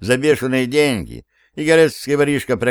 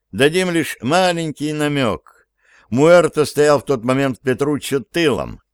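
usual speaking voice

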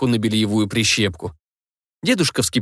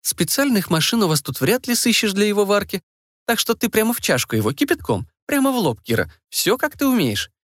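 provoking; 8 semitones higher than usual. A narrator's reading. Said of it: "принялась задираться гостья".

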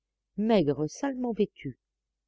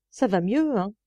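master's jovial cry, higher and louder; hearty exclamation; 4 semitones higher, 4 dB louder than usual. A narrator's reading. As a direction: call out cheerfully to the old man. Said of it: "s'écria monsieur en se frottant les mains". (s'écrie jovialement au vieux Pantois)

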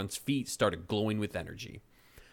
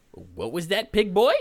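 taunting witch; 9 semitones higher, 7 dB louder than usual. Acted.

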